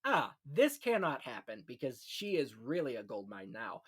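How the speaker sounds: ordinary talk, nothing marked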